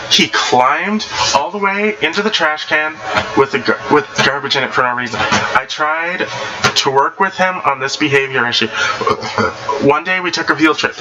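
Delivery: ordinary delivery, nothing marked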